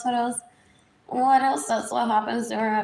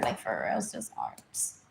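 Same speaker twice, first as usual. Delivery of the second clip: whispered aside; 6 dB quieter than usual. Real, not acted. Confiding to herself about art school, in a whisper. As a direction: drop to a breathy whisper.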